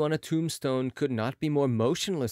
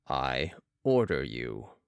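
deep, slow order; mesmerist's command; 12 semitones lower, slower than usual. A narrator's reading. Balsamo orders the mesmerised patient, deep slow command. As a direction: command him low and deliberately.

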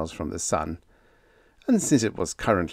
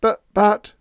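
loud, higher sputtering protest; flustered husband protesting; 12 semitones higher, 8 dB louder than usual. A narrator's reading. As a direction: splutter to get a word in. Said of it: "protested Lance".

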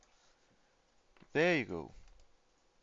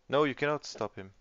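loud protest; 4 semitones higher, 12 dB louder than usual. Real, not acted. Protests the player's suggestion.

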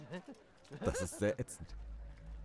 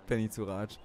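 ordinary delivery

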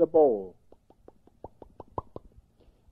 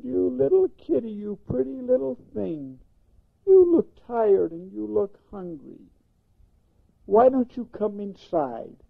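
lady's voice; 7 semitones higher than usual. Acted. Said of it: "And she said".

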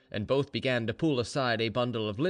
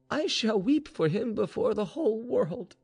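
anguished defiance; 10 semitones higher than usual. Acted. Grieving mistress defies disgrace.

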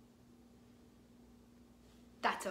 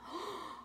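mock gasp; 11 semitones higher, 6 dB quieter than usual. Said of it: "Gasp, amazing".

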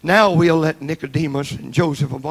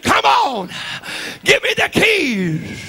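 commanding shout; 13 semitones higher, slower than usual. Acted. Jesus to the demons at the gate, high shout. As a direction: cry out for the keys.

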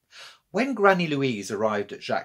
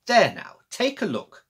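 proud granny voice; 9 semitones higher, 5 dB louder than usual. A narrator's reading. Granny shows off her work.

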